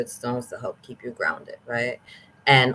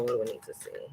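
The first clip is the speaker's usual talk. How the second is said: usual speaking voice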